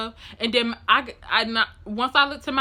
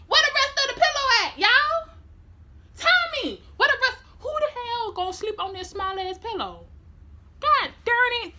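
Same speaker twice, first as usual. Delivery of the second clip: much higher, fast indignant complaint; mad rant; 12 semitones higher than usual. Retold or imitated. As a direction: squeal out your outrage over the tiny pillow. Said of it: "So I was so mad".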